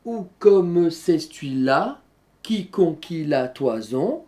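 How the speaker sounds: slow verse, clear break between "celui-là" and "qui conquit"; pause at mid-line. Shown as a demonstration. Teacher showing the hemistichs, slow verse with a pause after "celui-là". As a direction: recite slowly, halting after "celui-là" before "qui conquit la toison". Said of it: "tous les vers ont une pause en leur milieu".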